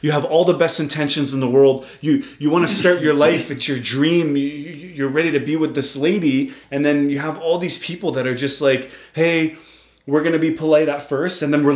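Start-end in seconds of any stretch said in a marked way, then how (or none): none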